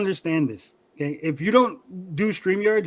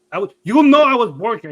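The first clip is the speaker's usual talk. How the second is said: louder, higher mock-indignant Mexican accent; dad's Mexican accent; 5 semitones higher, 8 dB louder than usual. Son mimics mad dad insisting on his birthplace, Mexican accent. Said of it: "I give you an accent".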